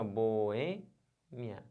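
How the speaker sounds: "boêmia" very slowly as boo-Ê... mia; syllables separated. Shown as a demonstration. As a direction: pull "boêmia" apart, holding bo and Ê. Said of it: "você separa assim". ("boêmia" separado, bo-ê-mia, bem devagar)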